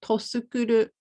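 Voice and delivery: "Tosukuru" with a vowel pushed in after the t, to-su-ku-ru; "Tosukuru", t split off as an extra "to" syllable; extra vowel added. Shown as a demonstration. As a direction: say "Tosukuru" like a foreigner adding a vowel before su. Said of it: "I added a jama iranai boin to tsukuru".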